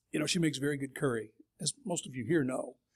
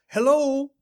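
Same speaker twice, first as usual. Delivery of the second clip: put-on kids' greeting; 9 semitones higher, 9 dB louder than usual. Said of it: "they'll smile and they go".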